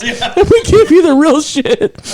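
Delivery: high, slow, through laughter; laughing. Real, not acted. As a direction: squeeze it out laughing. Laughing reveal.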